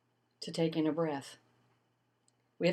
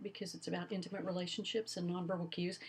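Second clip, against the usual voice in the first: hushed, fast aside; playful confidential whisper; 10 dB quieter, faster than usual. Acted. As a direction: drop to a secret whisper. Teacher hushes on tender topic.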